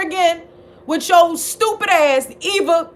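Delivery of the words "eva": usual speaking voice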